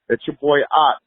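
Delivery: normal talking voice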